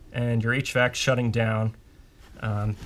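ordinary delivery

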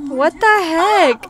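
whiny cry. Real, not acted. Sim died, whining.

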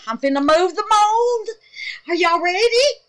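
nervous, eager anticipation; excited. Real, not acted. Asking viewers if they're ready, excited. About to unmold soap.

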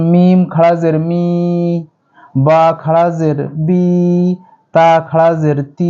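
slow, each final vowel held long; drawn out one alif. Shown as a demonstration. Teacher demonstrating the long vowel to students, drawn out. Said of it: "এগুলো এক আলিফ টেনে পড়বো".

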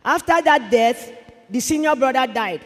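normal talking voice

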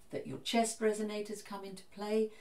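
usual speaking voice

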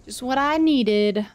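faking delight at gift. Real, not acted. Sarcastic reaction to unwanted item.

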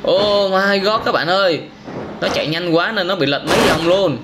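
usual speaking voice

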